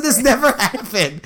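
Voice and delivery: retold denial, stretched out through laughter; laughing protest. Son retelling his denial to mom, laughing indignant protest.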